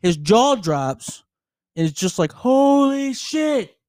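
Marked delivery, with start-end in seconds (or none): 2.3-3.8 high, very slow amazed gasp of words; stunned awe. Retold or imitated. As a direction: drag it out, jaw on the floor. Retells buddy's jaw dropping.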